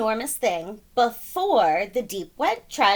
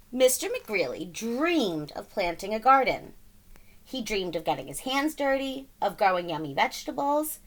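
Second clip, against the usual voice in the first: plain reading voice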